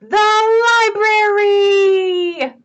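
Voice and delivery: high, slow, ringing call; grand announcement. Acted. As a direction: proclaim it like the big final opponent. Announces the final opponent.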